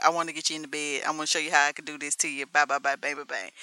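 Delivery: low, fast, monotonous rattle; mock blunt come-on. Host mocking straightforward music of today, low rapid come-on. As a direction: reel it off crudely, dropping in volume. Mimics blunt modern songs.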